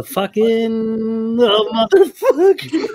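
high, laughing outburst of disbelief; excited shock. Real, not acted. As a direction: lose it laughing at the pull. Shocked by the last book.

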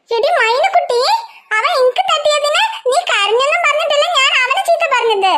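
usual speaking voice

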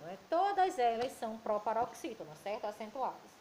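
ordinary talk, nothing marked

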